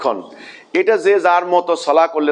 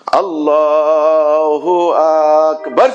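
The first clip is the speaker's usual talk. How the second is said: slow, loud আল্লাহু আকবার with rising Akbar; loud takbir; 4 dB louder, much slower than usual. Shown as a demonstration. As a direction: call it out loud so the congregation hears. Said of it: "তাকবীর হবে উচ্চস্বরে".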